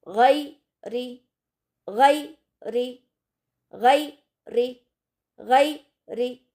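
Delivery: गै री split in two, गै heavy and thick, री thin and light, slow; syllable by syllable. Shown as a demonstration. Teacher drills गै री for students, heavy then thin. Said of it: "गै का एक सिलेबल लेंगे और री का एक सिलेबल".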